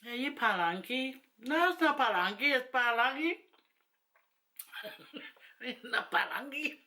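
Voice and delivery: mock the question, then correct it laughingly; amused mimicry. Vlogger cracking up at how people say palangi, amused mimicry. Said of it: "I crack up when people go".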